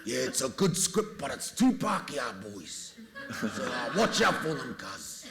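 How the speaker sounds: high, hammed-up matey warning; Temuera Morrison. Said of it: "Cliff got given the script by Temuera Morrison".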